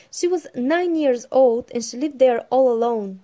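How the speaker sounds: loud, lively storytelling voice; story narration. Acted. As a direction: read it out like a bedtime storybook. Reads the Pippi story aloud.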